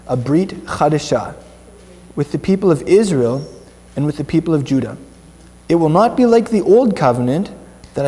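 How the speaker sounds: emphatic, measured phrases with clear pauses; solemn scripture reading. Acted. Reading Jeremiah's prophecy to the congregation, solemn and emphatic. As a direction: proclaim each phrase as God's own declaration.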